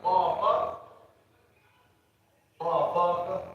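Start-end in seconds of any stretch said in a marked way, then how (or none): none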